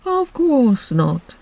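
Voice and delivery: slow, mournful turtle voice; Mock Turtle voice. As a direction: drag it out like a sad old turtle.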